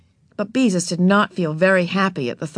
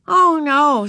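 put-on young girl voice; 9 semitones higher, 7 dB louder than usual. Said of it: "said Beezus".

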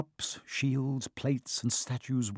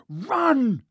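sudden high, loud yell; high alarmed shout to the others; 12 semitones higher, 5 dB louder than usual. A narrator's reading. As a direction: shout it in panic as you bolt. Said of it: "Harry yelled".

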